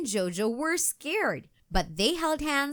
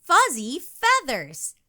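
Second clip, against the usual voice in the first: a bright, higher, louder storybook yell; 5 semitones higher, 6 dB louder than usual. A narrator's reading. Girl at a sleepover shouting out her word, mild yell. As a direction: call it out excitedly to your friends. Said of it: "yelled Jojo".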